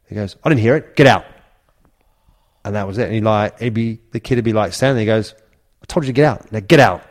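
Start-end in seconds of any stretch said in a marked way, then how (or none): 0.4-1.2 louder, higher barked order; retold teammate shouting at a youth kid, mock angry; imitated angry shout. Incy throwing kid out. 5.8-7.0 louder, firmer repeat of the command; retold star ordering the kid out again, mock angry; imitated angry order.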